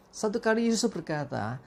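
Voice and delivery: normal talking voice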